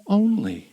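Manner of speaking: Only punched loud and hard as a quote; stress on Only. Retold or imitated. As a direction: hit the word Only with mock emphasis. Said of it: "He said".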